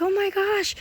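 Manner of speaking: high, breathy-free "oh my gosh" of sudden wonder; surprised gasp. Retold or imitated. Retelling a dream, seeing Princess Carly, surprised. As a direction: catch your breath at the sight.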